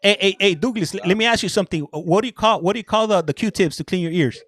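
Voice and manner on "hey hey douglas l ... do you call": ordinary talk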